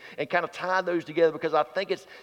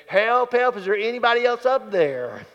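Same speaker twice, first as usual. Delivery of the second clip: higher, louder mock cry upward; acted call for help; 5 semitones higher, 4 dB louder than usual. Man on cliff wants another rescuer, acted calling out. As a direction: call out hopefully for someone else. Doubting man calls up again.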